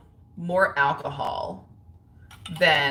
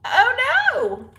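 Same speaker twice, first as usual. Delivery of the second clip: very high, loud squeal of dismay; shocked squeal; 6 dB louder than usual. Real, not acted. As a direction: yelp it out as something snaps. Dismay at breaking her tab.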